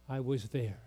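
soft, raspy and low. Retold or imitated. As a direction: rasp it quietly like an old woman. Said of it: "with her raspy voice".

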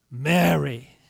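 low, lingering call of her name; tender naming. Acted. The stranger speaks her name to Mary, low and tender. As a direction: say the name low, as gentle recognition.

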